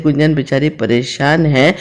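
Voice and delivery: plain reading voice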